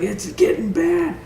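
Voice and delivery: high, slow groan of a dazed soldier; dazed complaint. Retold or imitated. To the lieutenant about the ringing, high slow dazed voice. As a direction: drag it out, stunned. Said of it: "I was just like".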